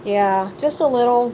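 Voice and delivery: ordinary talk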